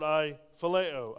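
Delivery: "phileo" said slowly and loudly, each syllable given weight; stressed Greek word. Shown as a demonstration. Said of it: "the Greek there is agape".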